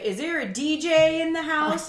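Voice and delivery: DJ announcing. Retold or imitated. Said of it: "so he's like".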